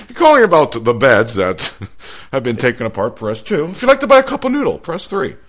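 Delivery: mock recorded menu, rising to high and fast; hammy automated hotline voice; phone menu voice. Continuing the phone menu parody.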